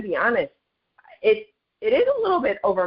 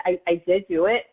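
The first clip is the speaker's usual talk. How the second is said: normal talking voice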